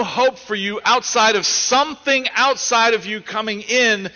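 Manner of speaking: fiery pulpit preaching, pressing hard; preaching at full force. Acted. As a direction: build the sermon to a fervent climax.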